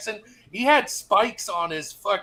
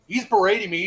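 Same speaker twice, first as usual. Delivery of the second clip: ordinary talk, nothing marked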